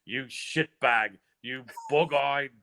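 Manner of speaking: slow, mocking British trash-talk rant; imitating a brawler's insult, high and drawn out; mock Tyson Fury insult. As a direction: ham up the British insult, drawing it out. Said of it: "And then to hear a guy say".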